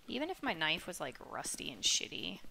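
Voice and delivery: normal talking voice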